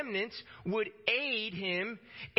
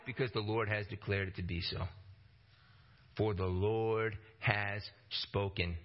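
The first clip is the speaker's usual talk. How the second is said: solemn declaration; 10 semitones lower than usual. Acted. Solemn declaration of God's decree.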